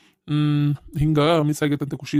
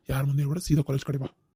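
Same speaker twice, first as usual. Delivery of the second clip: much softer, confiding, keep it between us; hushed secret; 3 semitones lower, 6 dB quieter than usual. Acted. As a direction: drop the voice so nobody overhears. Friend asks to keep it secret. (ಗುಟ್ಟಾಗಿ ಮೆಲ್ಲಗೆ ಹೇಳು)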